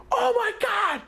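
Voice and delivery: high-pitched panicked scream; re-enacted scream. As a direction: scream it out high, reliving the shock. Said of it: "I just start screaming".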